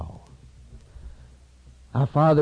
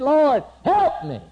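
desperate plea; 13 semitones higher, 6 dB louder, slower than usual. Acted. Woman pleads to the Lord.

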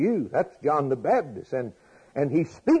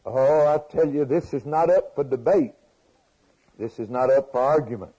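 preaching; 3 semitones higher, 5 dB louder than usual. Acted. Insists from the pulpit.

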